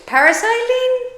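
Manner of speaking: squeaky high on parasailing; parasailing said in a high, squeaky excited voice. Real, not acted. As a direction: pitch it way up with excitement.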